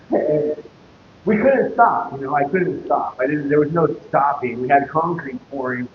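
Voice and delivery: ordinary delivery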